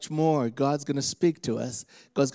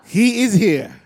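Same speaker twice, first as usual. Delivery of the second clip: high, loud proclamation; preaching shout; 5 semitones higher, 8 dB louder, slower than usual. Shown as a demonstration. Preacher has congregation tell neighbors, shouted. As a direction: proclaim it for the crowd to echo. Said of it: "Look at your neighbor, say".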